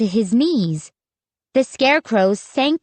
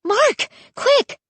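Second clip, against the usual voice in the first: high, excited order; urgent cry; 11 semitones higher than usual. A narrator's reading. Child urging brother Mark to act, crying urgently. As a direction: call it out urgently, pitched way up. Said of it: "I cried".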